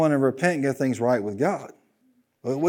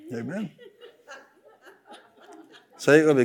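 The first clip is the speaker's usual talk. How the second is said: ordinary talk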